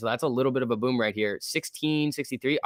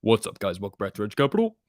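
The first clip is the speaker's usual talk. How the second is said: Wrench impression; 3 semitones lower than usual. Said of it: "Can you do the wrench impression?".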